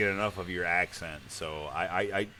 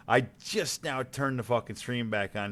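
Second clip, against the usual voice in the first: ordinary talk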